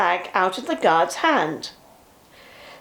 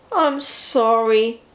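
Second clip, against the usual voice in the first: unhappy; slower than usual. A narrator's reading. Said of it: "said Bertie unhappily".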